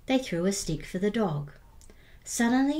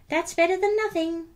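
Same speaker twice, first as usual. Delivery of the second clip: much higher little skeleton voice; 10 semitones higher, 4 dB louder than usual. Acted. Little skeleton with a playful idea, very high. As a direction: squeak it with glee like a small child. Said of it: "he said".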